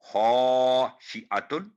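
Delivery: खाशिअतुन with a thick, heavy खा, drawn out slowly; heavy full-mouthed kha. Shown as a demonstration. Teacher demonstrating Quran recitation, heavy खा in खाशिअतुन. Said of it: "ये भी खा पुर करके".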